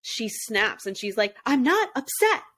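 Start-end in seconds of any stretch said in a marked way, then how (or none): 1.4-2.4 snapping "I'm not upset", high and a little louder; mild imitation of her snapping; raised voice. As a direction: finally snap back, voice up. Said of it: "she raises her voice a little bit there".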